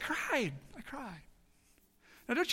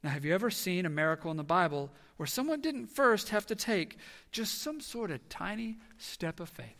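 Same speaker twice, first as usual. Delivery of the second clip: normal talking voice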